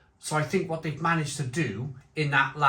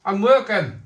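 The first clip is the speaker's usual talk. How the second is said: angry shout; 7 semitones higher, 10 dB louder than usual. Real, not acted. Shouting back at angry neighbour.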